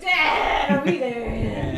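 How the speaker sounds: put-on loud, drawn-out kid whine; mock back-seat whine, slow and loud; whining child. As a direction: stretch it out like an impatient kid. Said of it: "I'm like a child right now".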